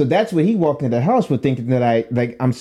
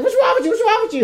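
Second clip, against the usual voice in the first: very high, frantic mother voice; freaking-out mom; faster than usual. Retold or imitated. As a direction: repeat it in a panicked squeal, mimicking Mom. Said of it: "she's freaking".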